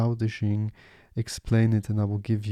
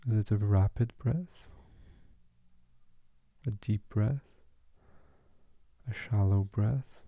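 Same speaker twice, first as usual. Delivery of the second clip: guided meditation. Acted.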